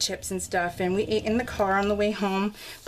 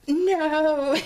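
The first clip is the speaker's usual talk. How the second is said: raised, louder drawn-out protest; mock death-sentence wail; 6 semitones higher, 5 dB louder than usual. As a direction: imitate a doomed kid's dismay. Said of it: "a death sentence".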